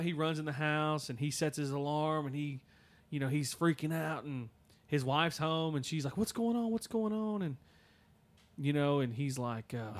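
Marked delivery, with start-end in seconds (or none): 6.2-7.4 high, alarmed repeated question; a frightened wife pressing for answers; startled wife. Retold or imitated. Wife asks her panicked husband what happened, alarmed and high.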